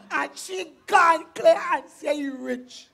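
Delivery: loud, slow, indignant complaint; exasperated. Acted. Daughter complains mother believed the suitor, loud exasperation. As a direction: hammer it out in slow outrage.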